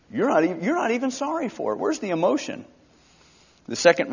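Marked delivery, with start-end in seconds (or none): 0.1-2.6 quick, higher-pitched scoffing; mock his lack of tears; lightly voiced sneering critic; scornful accuser. Voicing judgmental critic.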